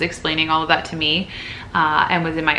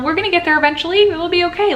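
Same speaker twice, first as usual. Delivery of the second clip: upbeat reassurance; 12 semitones higher, 4 dB louder, faster than usual. Real, not acted. Encouraging struggling viewers.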